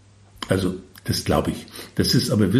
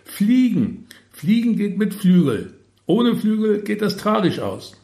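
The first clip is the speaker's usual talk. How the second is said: high-pitched scolding call; exasperated shouted reprimand, pitched way up; bellowing capo; 11 semitones higher than usual. A narrator's reading. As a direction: yell a basic rule at a bungling subordinate. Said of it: "brüllt ihn der Capo an".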